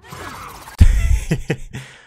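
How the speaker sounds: airy laugh, loud; cracking up loudly; loud breathy laugh. Real, not acted. Laughing at the turtle line.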